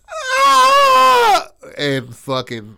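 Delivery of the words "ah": scream. Retold or imitated. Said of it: "Phyllis screams".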